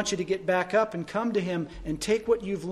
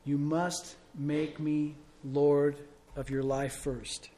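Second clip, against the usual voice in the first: slow, weighty voice of Jesus insisting; a slow, solemn command; emphatic; 3 semitones lower, slower than usual. Retold or imitated. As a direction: lay down each word slowly and firmly. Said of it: "He emphatically says".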